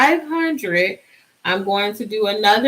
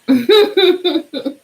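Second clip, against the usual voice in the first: laugh; 7 semitones higher, 4 dB louder than usual. Real, not acted. Laughs about Danielle from work.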